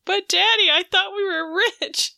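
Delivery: lightly hammed-up pleading; mock whine.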